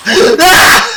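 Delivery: sudden high shriek of fright; jump-scare scream, loud and high; terrified scream. Real, not acted. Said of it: "il m'a fait peur".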